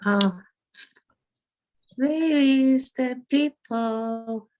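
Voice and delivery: normal talking voice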